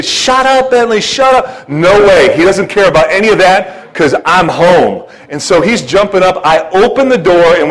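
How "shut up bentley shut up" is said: high-pitched exasperated mock yell; mock household shout, pitched way up; imitated yelling. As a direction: yell at the barking dog, in imitation. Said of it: "People in the house are saying".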